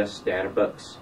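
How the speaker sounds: put-on slow, lightly mumbled Scottish accent on STARBUCKS; mock Scottish STARBUCKS. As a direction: squeeze out STARBUCKS like a struggling Scot. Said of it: "better than Irish and Scottish people".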